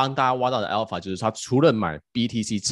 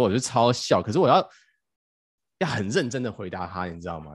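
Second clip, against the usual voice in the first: ordinary delivery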